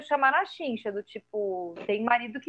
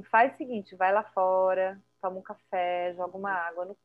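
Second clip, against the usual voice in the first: calm advice to husband; 5 semitones lower, 4 dB quieter than usual. Retold or imitated. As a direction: gently talk the trembling husband down. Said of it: "chama ele num canto e fala assim".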